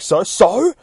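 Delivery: high, loud mock outrage; acted-out indignant squawk; incredulous echo. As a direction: throw the word back as an outraged yell. Indignant echo of shooter's shrug.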